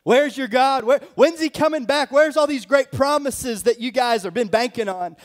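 high, loud mocking taunt; scoffing world. Preacher voicing the world's scorn, loud high sneer. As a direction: sneer at believers as weak.

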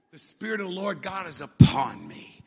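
ordinary talk

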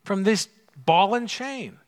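mock them, luring and sly; mocking tempters. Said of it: "they're coming out to say".

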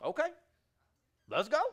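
eager yes. Retold or imitated. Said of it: "She's like".

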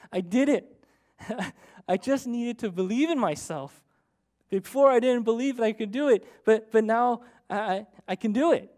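a daughter telling her dad she did it, excited; happy child. Retold or imitated. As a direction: burst out with delight at success. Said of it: "she was so happy".

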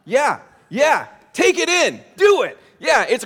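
a fed-up parent pushing back, rising sharply; exasperated parent. Retold or imitated. Parent insisting on chores.

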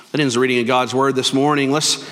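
ordinary talk

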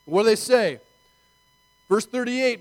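usual speaking voice

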